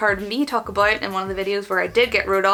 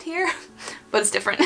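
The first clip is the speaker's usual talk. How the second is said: laughing; 9 semitones higher, faster than usual. Real, not acted. Laughs while talking.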